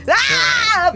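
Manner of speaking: sharp high cry of road rage; mock frustrated yell. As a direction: blurt out an angry ah at the traffic. Honking angrily at drivers. (grito de frustración al volante)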